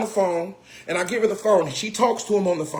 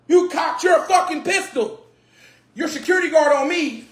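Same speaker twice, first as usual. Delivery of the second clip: outraged shout; 5 semitones higher than usual. Real, not acted. Comedian ranting at the owner who pulled a gun, outraged shout. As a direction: call him out at full voice.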